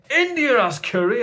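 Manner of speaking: high, loud indignant retort; mock indignant troll shout. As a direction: fire it back like an offended commenter. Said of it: "they go straight away".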